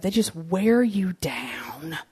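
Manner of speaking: slow hushed whisper. Real, not acted. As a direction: breathe it out like a confidence. Aside about moms wearing you down.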